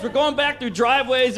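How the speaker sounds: ordinary talk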